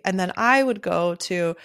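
ordinary delivery

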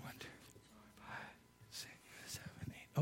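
counting in a whisper. Real, not acted. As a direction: whisper the numbers to yourself. Counting people under his breath.

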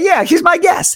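put-on a thrilled kid showing off a little; proud declaration. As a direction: announce it like you can't believe it. Proudly telling the attendant.

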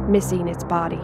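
ordinary delivery

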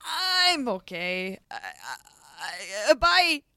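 stall, then bolt out a bye; awkward exit. Acted. Mari awkwardly ends the chat, slow then bye. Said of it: "Mari awkwardly excuses herself".

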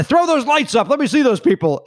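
calling out as [name]. Retold or imitated.

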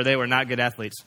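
usual speaking voice

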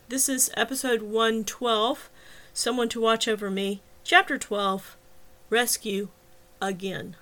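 usual speaking voice

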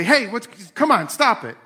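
re-enacted dad's outburst, toned down a little; retold yell. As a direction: yell to get the kids' attention. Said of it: "So I yell out".

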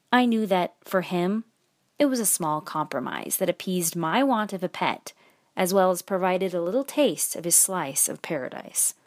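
plain reading voice

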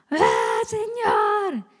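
high, drawn-out acted wail; crying out to God. Unburdening to God in the morning, acted high cry. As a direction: cry up to the Lord in a high, stretched voice.

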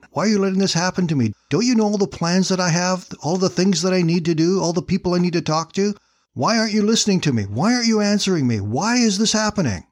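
high, fast, desperate questions to God; desperate questioning. Acted. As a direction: plead like a man abandoned on an island. Stranded man questioning God.

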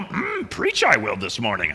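lightly voiced Yoda, low and croaky; a hint of a Yoda impression. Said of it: "in the voice of Yoda".

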